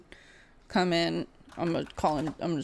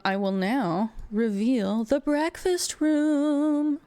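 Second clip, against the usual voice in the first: grand reveal; 6 semitones higher, much slower than usual. Acted. GM announces new room.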